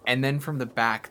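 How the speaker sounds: ordinary talk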